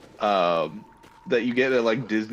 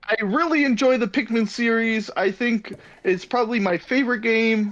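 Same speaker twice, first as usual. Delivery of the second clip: Psylocke; 9 semitones higher than usual. Pretend. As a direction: gush like a hyped streamer. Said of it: "Psylocke here".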